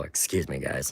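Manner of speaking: ordinary talk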